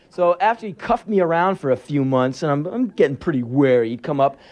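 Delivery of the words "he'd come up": usual speaking voice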